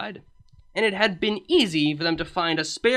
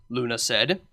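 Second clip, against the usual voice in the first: ordinary delivery, nothing marked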